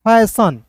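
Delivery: "फैशन" with a short final shun; right pronunciation. Shown as a demonstration. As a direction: say "फैशन" clearly. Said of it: "पढ़ेंगे न". ("फैशन" का उच्चारण)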